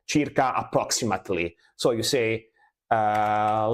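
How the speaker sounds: ordinary talk, nothing marked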